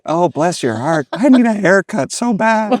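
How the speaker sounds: high, loud mock-desperate plea; mock pleading. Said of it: "And I was like".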